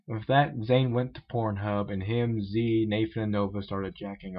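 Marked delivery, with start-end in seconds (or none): none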